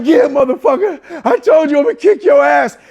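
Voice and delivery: very loud triumphant taunt; defiant gloating shout. Retold or imitated. Patient to the kicked dentist, loud defiant gloating. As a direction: shout it back in triumph, acting it out.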